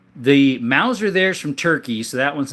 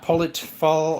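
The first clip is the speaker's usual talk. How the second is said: ordinary talk, nothing marked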